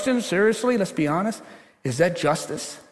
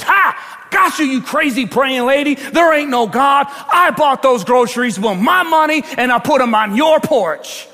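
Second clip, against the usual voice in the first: higher, emphatic mocking gotcha; gloating taunt; 6 semitones higher, 4 dB louder than usual. Prankster jumps out of the bushes at praying lady, gloating taunt. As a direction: jump out and crow over the trick.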